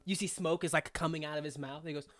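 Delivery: ordinary talk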